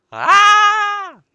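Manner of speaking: piercing high scream of terror; sudden terrified scream; terrified shriek. Acted. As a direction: shriek as if you've just seen a throat cut. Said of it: "A student shrieked in terror".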